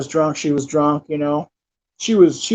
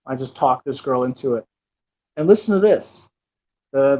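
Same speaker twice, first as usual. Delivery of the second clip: normal talking voice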